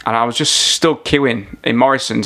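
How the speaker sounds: ordinary talk, nothing marked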